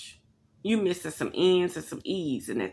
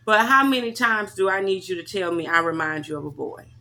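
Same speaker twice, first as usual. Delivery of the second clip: ordinary talk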